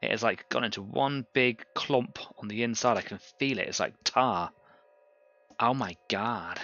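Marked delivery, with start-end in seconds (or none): 3.7-6.6 slow, drawn-out mock accent on a disgusted "Oh my god"; jokey foreign-sounding accent, half-hearted; put-on accent. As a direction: slip into a silly accent, drag it out. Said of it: "So sorry about that accent".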